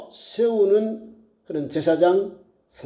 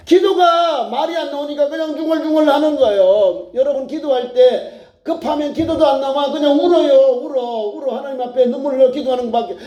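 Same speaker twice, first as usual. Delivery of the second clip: higher, driving sermon delivery; fervent preaching; 7 semitones higher than usual. Real, not acted. Preacher telling the congregation to weep in prayer, fervent exhortation. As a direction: press on urgently from phrase to phrase.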